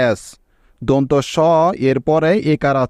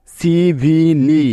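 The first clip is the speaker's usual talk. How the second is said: slow syllables; slower than usual. Shown as a demonstration. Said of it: "মিলে হলো লি".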